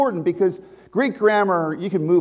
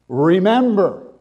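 Remember. slow and weighty, a firm command; commanding imperative; 4 dB louder, much slower than usual. Shown as a demonstration. Preacher showing Paul's command, slow and firm. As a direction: say Remember. as an order, drawn out. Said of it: "It's the imperative".

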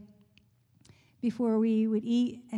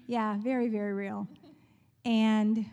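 ordinary talk

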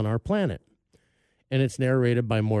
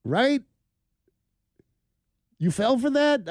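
imitating wife teasing; 7 semitones higher, 3 dB louder than usual. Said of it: "She's like".

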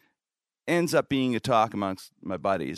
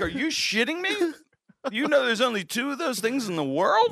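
much higher, incredulous at his wife; how could you, disbelief; incredulous rant; 12 semitones higher than usual. Retold or imitated. To his wife about the prop, high incredulous. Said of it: "I'm like".

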